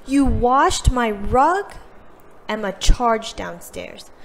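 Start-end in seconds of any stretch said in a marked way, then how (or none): 0.1-1.7 shocked disbelief. Acted. Emma learns rug was washed.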